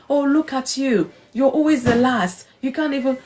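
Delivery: high, sneering put-down; mocking taunt. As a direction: jeer at the tired runner from above. A known voice taunting the runner.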